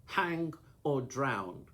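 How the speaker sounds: loud, slow shout over roaring water. Acted. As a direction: bellow it into his ear above the falls. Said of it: "he shouted".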